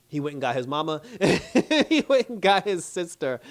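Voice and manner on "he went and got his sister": laughing through it, higher and louder; can barely get it out for laughing. Real, not acted. Recapping the show to viewers, laughing hard at the rescue.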